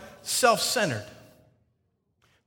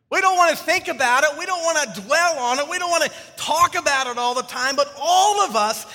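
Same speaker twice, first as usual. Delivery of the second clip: preaching; 7 semitones higher than usual. Acted. Pastor drives the point home to congregation, fervent preaching. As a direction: preach it with rising fervor.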